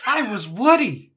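high, loud mock wail; hammed-up tearful boast; mock wailing star. Host voicing a wounded actor, in a loud mock wail. Voices indignant Hanks boasting credits.

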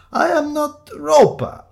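high, loud, laboured protest; exasperated denial. Acted. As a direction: insist slowly at full voice. Damp man denies, exasperated.

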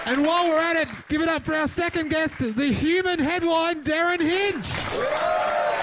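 high, big game-show announcer. Acted. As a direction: introduce the guests like a showman. Announcing guests show style.

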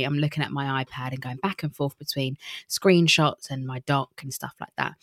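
normal talking voice

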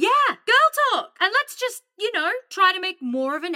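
high, loud, bright agreement; eager agreement. Acted. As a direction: jump on the idea eagerly. Eagerly agreeing to girl talk.

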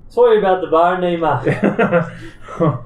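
put-on Irish accent. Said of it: "That was Tom's accent".

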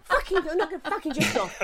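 high laughing mock rant; laughing at her own midnight swearing; laughing through it. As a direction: giggle your way through the grumble. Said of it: "this is impression of me".

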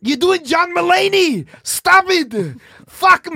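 put-on loud, high Austrian-accented retort; Arnold Schwarzenegger. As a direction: yell back like an indignant action hero.

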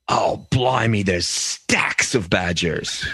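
drawn-out British "stacks of badgers"; British pub local. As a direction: imitate a British man in a pub. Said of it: "which is what they call a beer there".

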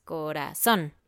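"corazón" slow, stress landing on -zón; correct final stress. Shown as a demonstration. Teacher correcting the wrong versions, "corazón" stressed on the end. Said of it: "la parte fuerte al final de la palabra".

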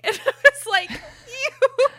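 high, loud laugh breaking the words; can't stop giggling mid-line. Real, not acted. Telling cohost about Holly's reply, laughing.

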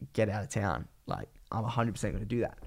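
normal talking voice

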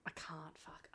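whispered swear under breath; hushed, caught-out curse; muttered curse. Real, not acted. Scolded for saying cocaine.

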